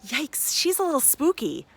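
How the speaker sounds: mock yikes. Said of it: "you thought".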